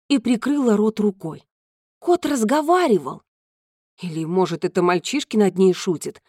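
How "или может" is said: ordinary delivery, nothing marked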